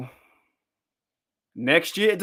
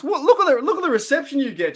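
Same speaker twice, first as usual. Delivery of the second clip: much higher, playful wow; teasing a viewer about her welcome with much higher mock amazement; 12 semitones higher than usual.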